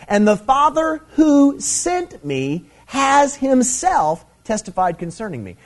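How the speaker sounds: slow, high, ringing scripture read. Acted. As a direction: read the verse out slowly like a proclamation. Preacher reads scripture verse dramatically.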